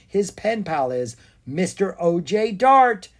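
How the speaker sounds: high, drawn-out, delighted guess; loud, slow burst of recognition. A narrator's reading. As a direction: build to the name with rising delight.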